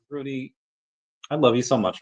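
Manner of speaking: normal talking voice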